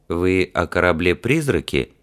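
usual speaking voice

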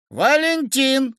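high, drawn-out call to a husband; wife shouting down, long high vowels. A narrator's reading. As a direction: call the name from an upstairs window. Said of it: "крикнула Кацу жена со второго этажа".

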